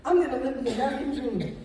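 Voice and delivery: ordinary talk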